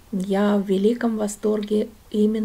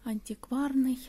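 whispering softly so as not to disturb the shop; hushed whisper; 13 dB quieter than usual. Real, not acted. Host guides viewers through an antique shop, whispering. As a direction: keep it a quiet, breathy whisper among the antiques.

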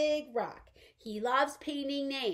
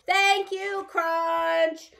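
high, loud, sung-out thank you; startlingly loud thanks; 4 semitones higher, 5 dB louder, much slower than usual. Pretend. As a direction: call it out too loudly for a shy dinosaur. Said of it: "your voice startled him".

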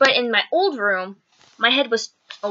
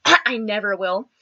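ordinary delivery

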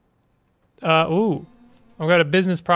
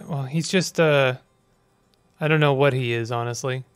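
normal talking voice

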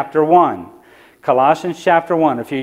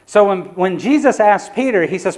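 ordinary talk

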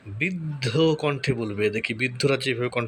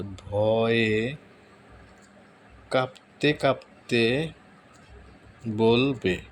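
drawn-out words with long gaps; slow dictation; 3 semitones lower, much slower than usual. Real, not acted. Tutorial host typing out a prompt, slow dictation. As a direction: say it word by word as you type.

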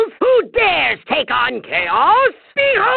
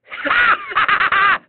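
evil cackle; 5 semitones higher than usual. Acted. Villain gloats.

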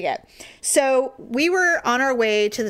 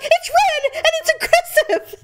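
high-pitched frantic shout; panicked insistence; 4 dB louder than usual. Retold or imitated. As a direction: defend the panic hysterically. Her panicked defence, acted out.

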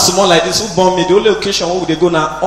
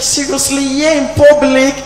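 put-on girl's disbelief; 7 semitones higher, slower than usual. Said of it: "She say, like".

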